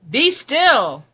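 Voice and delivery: loud, high firm command. Acted. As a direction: order the monsters to freeze. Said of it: "Till Max said".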